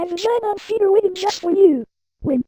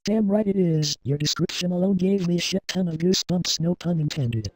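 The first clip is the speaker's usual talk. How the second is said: very low, pitched-down sinister voice; menacing mastermind voice, far lower; deep villain voice; 6 dB quieter than usual. Acted. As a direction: drop it way down like a scheming rebel boss. Deep altered host voice.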